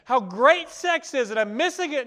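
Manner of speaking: high, loud pleading whine; desperate complaint to God. Retold or imitated. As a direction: beg God to take it back. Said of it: "you're saying to God".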